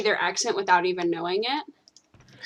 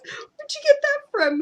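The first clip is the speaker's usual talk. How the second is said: high, surprised little squeak; high mock friend voice; 3 dB louder than usual. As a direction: play the baffled friend, pitched way up. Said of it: "He goes".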